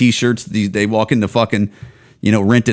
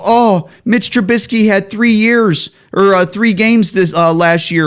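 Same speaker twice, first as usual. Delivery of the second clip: slower, higher put-on voice of a hopeful fan; mock fan excuse; 7 semitones higher than usual. Vlogger mocking Bears fans who defend Trubisky, in a higher, slower put-on voice. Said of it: "you go".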